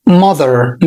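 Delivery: "mother" with a short uh sound; model pronunciation. Shown as a demonstration. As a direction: say "mother" clearly for the learner. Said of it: "أو كلمة". (نطق mother بوضوح)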